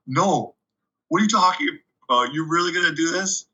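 high-pitched disbelieving quote; retold incredulity, pitch jumping up; incredulous coach. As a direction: act out the coach's stunned questioning. Acts out coach's shocked reaction.

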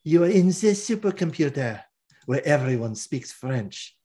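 hammed-up French woman voice; French-accented villainess, lightly played; terrible French accent. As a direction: put on a cheesy French accent. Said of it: "my terrible French accent".

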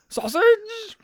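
loud, very high comic catchphrase; Blackadder impression. Podcaster repeating a sitcom catchphrase for laughs, loud high-pitched impression. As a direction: belt it out high and drawn out. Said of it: "From Blackadder".

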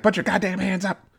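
high-pitched mock cop command; cop barking orders. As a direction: play the nervous cop shouting at the killer. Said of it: "The cop's like".

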